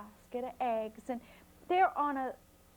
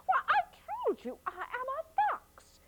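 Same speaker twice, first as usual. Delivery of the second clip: exasperated fox; faster than usual. A narrator's reading.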